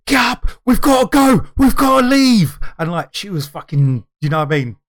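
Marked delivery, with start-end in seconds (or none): 0.1-2.6 high, loud panicked orders; urgent shouting. Retold or imitated. Husband shaking his wife awake, shouting urgently. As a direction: shout to rouse someone and flee. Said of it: "And I shook my wife".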